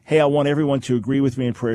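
ordinary talk, nothing marked

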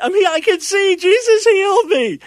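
very high, loud jubilant yell; joyful shouting. Acted. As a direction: act the healed man shouting to the church. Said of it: "jumping and shouting and saying".

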